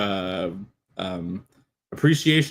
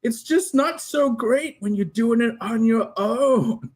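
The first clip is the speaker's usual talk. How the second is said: a hint of a sad young hero; mild wistful boy; lightly voiced pensive Connell; 8 semitones higher than usual. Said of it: "he's like".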